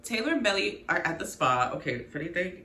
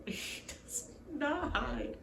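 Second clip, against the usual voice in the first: laughing; 6 semitones higher, 9 dB quieter, slower than usual. Real, not acted. Laughing recap.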